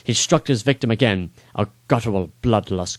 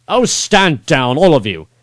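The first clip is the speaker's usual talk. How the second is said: king ordering his guards down; commanding shout; 5 semitones higher, 7 dB louder than usual. Acted. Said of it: "He shouted at his guards".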